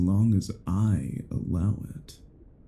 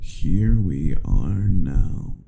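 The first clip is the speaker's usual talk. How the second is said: slow, drawn-out and louder; slow gloat; 3 semitones lower, 5 dB louder, much slower than usual. Acted. Vampire to captive werewolf, slow savouring gloat. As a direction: lean into each word, savouring the moment.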